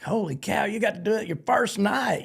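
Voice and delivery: high, impressed outburst; amazed exclamation. Retold or imitated. Suite guests amazed at first-night barrel move, high excited outburst. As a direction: gush like you can't believe it. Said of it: "they're all like".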